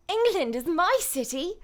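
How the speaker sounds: put-on high, perky-pushed English accent; Tracer. Voice actor joking with chat in a character voice, Tracer impression. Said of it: "In a Tracer voice".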